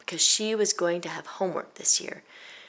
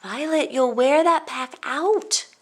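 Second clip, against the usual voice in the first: high, slow, louder mother's call; 9 semitones higher, 5 dB louder, slower than usual. A narrator's reading. Mother calling to Violet about her pack, high and slow. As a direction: call across the house like a fond mom. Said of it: "until her mother called".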